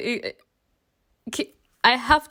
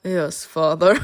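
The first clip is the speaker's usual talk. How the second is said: resigned obedience; 4 semitones lower than usual. Acted. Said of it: "and he's like, "Yes, dear".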